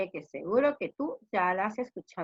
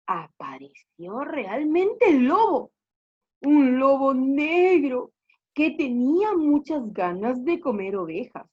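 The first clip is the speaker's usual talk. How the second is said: dramatic story narration; 4 semitones higher, 6 dB louder than usual. Acted. Teacher reveals the real wolf to pupils, dramatic. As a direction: draw out the menace for young listeners. Builds suspense as wolf appears.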